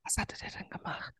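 hushed whisper. Real, not acted. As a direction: whisper so no one wakes up.

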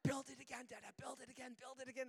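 imitated toddler's insistent chant; high, breathless little-kid pleading; small child. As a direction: beg repeatedly like an excited three-year-old. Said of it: "he looks at me and says".

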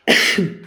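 hard real cough from choking. Real, not acted. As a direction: cough out a drink gone down wrong. (kräftiges Husten nach dem Verschlucken)

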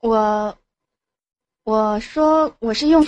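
slow, hesitant start with gaps; halting, stammering. Real, not acted. Caller asked about her job, halting and stammering. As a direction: grope for the words, restarting. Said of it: "磕磕巴巴的".

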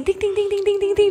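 tossing off tiny answers; curt little replies. Retold or imitated. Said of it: "só as respostinhas". (respostinhas curtinhas e agudas)

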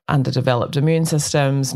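usual speaking voice

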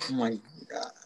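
breathy whisper under the breath. Real, not acted. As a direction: whisper it to yourself. Reacts under her breath.

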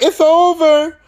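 high, loud cheer; hammed-up relief; mock relief. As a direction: celebrate the win. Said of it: "They're like".

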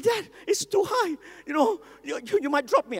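put-on a toddler afraid of the height; scared little boy. Son on the table begging dad, high fearful child voice.

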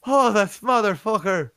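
a hammed-up Scottish killer cursing his victim; mock Scottish accent. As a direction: snarl it like a cartoon Scot. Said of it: "I don't even know if that's how they talk".

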